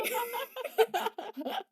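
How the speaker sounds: squealing high laugh; high giggle. Real, not acted. Laughing at the scene.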